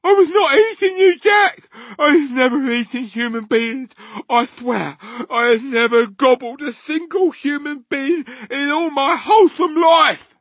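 high, loud shrieked protest; panicked giant pleading his case; frantic screech. A narrator's reading. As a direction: swear innocence at a scream. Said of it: "he screeched".

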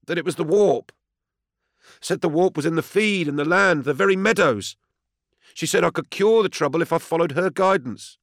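high, pleading farmer; high-pitched distressed confession; desperate defendant. A narrator's reading. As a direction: plead your hardship in a high, urgent voice. Desperate defendant recounts his troubles.

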